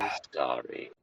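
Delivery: a hammed-up insincere sorry; mock apology. Mocking an insincere corporate apology.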